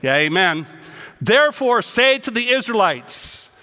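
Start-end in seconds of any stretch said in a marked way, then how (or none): 1.2-3.5 preaching at full force. Acted. Preacher proclaiming God's words to his congregation, loud. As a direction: declare each phrase like thunder.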